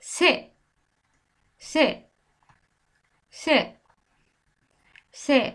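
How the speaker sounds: 「সে」 said as Bengali se, not English see; Spanish ce. Shown as a demonstration. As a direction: say 「সে」 short and repeat it slowly. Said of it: "আমি সিকে কি বলছি".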